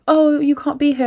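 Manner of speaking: high, loud mock reprimand; flight attendant telling off. Flight attendant to a passenger in the way, high mock scolding. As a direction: imitate a scolding attendant, pitched well up.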